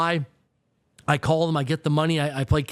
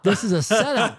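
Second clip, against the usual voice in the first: laughing realization; 4 semitones higher than usual. Retold or imitated. Inner thought, realizing a setup.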